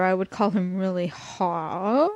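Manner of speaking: plain reading voice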